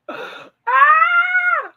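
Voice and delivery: loud, very high drawn-out squeal; high scream. Real, not acted. Vlogger breaks off the chat, loud high-pitched scream. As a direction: let out a piercing held shriek. Sudden cry during live chat.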